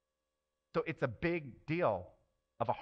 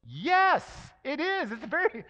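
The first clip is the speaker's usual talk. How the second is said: shouted higher and louder; forceful yes; 13 semitones higher, 10 dB louder, much slower than usual. Real, not acted. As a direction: slam the objection down.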